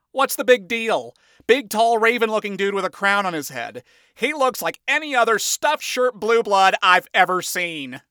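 loud, scoffing imp voice; dismissive. A narrator's reading. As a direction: scoff at the fuss. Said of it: "Blitzo remarked".

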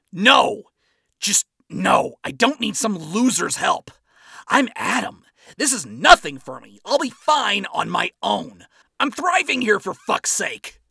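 loud, high, cornered yelling; agitated shouting. Acted. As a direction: yell like a cornered animal. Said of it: "spat out in agitation".

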